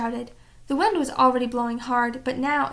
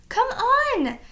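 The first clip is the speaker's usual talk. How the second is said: high urgent shout; 10 semitones higher than usual. A narrator's reading. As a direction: yell it from the window. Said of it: "Annie was shouting".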